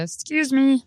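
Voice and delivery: high, dainty excuse me like a gentle horn; polite little honk. Retold or imitated. Comedian imitating a polite honk: dainty high excuse me. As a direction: mimic a polite car horn saying excuse me. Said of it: "it's just like a".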